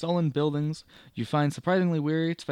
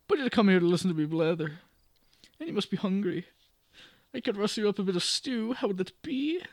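higher lilting Irish offer; Irish landlady; 6 semitones higher, 3 dB louder than usual. Acted. May offering stew to the guest, Irish lilt. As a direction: fuss over him, Irish. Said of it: "let me go full Irish".

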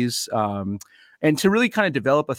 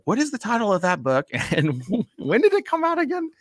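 puzzled recalling; 10 semitones higher than usual. Retold or imitated.

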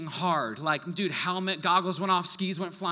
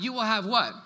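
prompting the crowd to answer; said with more push; 4 dB louder than usual. Shown as a demonstration. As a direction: pastor urging the congregation to answer with more push. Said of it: "you can say it louder than that".

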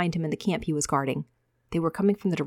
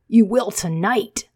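slow, louder cruel snap; harsh retort; 3 semitones higher, 6 dB louder, slower than usual. Acted. Micah mocking the boy, slow and louder. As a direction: spit it out with cruel satisfaction.

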